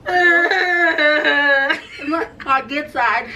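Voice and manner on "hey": normal talking voice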